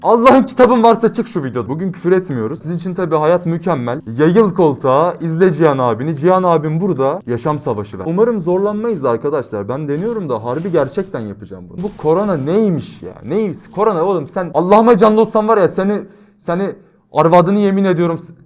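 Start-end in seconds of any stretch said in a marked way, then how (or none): 0.0-1.7 louder, higher mock yell telling viewers to get out; playful exasperated shout. Shooing viewers out, mock anger. 13.7-18.3 louder, higher rant that cuts off before a curse; heated outburst stopped mid-swear; angry rant. Real, not acted. Ranting at corona, nearly swears.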